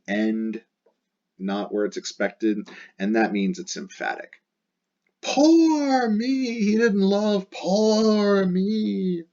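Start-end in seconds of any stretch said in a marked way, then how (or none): 5.2-9.2 much higher, louder wailing poor me; emotional lament. Acted. Teacher voicing Dido wailing over her lost love. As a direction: wail it out like a heartbroken queen. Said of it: "this is getting really emotional".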